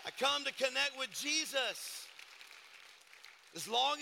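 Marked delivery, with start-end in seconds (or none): 0.0-2.1 louder, higher repeated proclamation; preaching emphasis. Real, not acted. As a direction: say it again with full preaching force.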